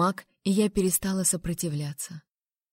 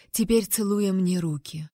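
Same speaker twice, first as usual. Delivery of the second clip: ordinary delivery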